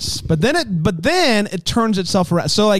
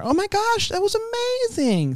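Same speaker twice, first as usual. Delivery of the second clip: put-on high, soft bubbly teen voice; happy teen girl; 5 dB quieter than usual. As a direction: squeal it like a peppy high schooler. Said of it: "practicing her".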